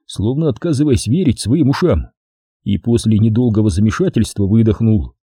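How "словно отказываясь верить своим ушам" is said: plain reading voice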